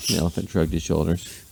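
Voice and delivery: ordinary delivery, nothing marked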